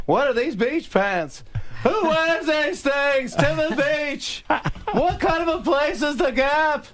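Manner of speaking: high, slow, drawn-out comic shouting; Jerry Seinfeld yelling. Acted. Guest ranting about The Gap, high yelled impression. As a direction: yell each incredulous question at full pitch. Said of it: "So we're just yelling back and forth".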